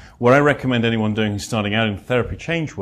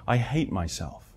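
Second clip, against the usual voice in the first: quiet flat confession; 7 dB quieter than usual. Retold or imitated. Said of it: "she said these words".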